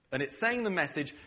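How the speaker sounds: normal talking voice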